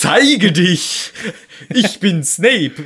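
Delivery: high, loud, slow mock incantation; hammed-up spell. Co-host mocks Snape commanding the map, high, loud, slow. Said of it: "Er sagt".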